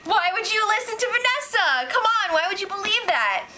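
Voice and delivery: incredulous Meg. Retold or imitated. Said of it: "Meg was just like".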